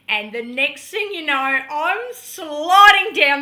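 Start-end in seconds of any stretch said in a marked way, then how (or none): 1.3-3.4 loud, with "sliding" stretched out; thrilled. Real, not acted. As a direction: swoop through "sliding", slow and big. Relives the rush down.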